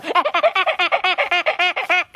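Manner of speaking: high, rapid repeated cackling squeaks; balloon sound imitation. Shown as a demonstration. Showing how the balloon sounds, squeaky cackle. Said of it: "Suara balon gimana?".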